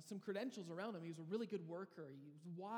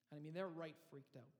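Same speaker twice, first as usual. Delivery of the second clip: ordinary delivery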